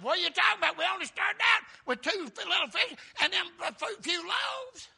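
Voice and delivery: high, loud protest, stumbling over words; incredulous shout. Acted. Philip protesting to Jesus about the leftovers, incredulous shout. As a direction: squawk it in hammed-up disbelief.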